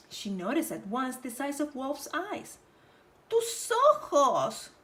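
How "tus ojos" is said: higher, slow amazed; slow wondering. Acted. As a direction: stretch it out in wonder. Roja noticing wolf's eyes.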